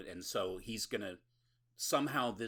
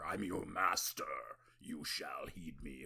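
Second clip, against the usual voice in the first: mock vampire command; 4 semitones lower than usual. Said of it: "or whatever".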